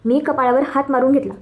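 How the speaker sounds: ordinary delivery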